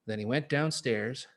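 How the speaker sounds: plain reading voice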